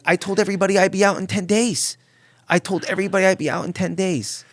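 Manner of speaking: high, loud panicky protest; panicked protest. Retold or imitated. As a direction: plead in a panic. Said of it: "And I'm like".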